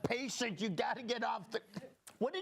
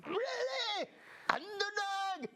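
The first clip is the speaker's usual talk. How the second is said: higher, bright cartoon voice, hamming up the hero; higher-pitched hammed-up cartoon hero; 7 semitones higher than usual. Kindergartner playing Underdog, a higher hammed-up cartoon voice. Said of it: "try to incorporate elements from Underdog".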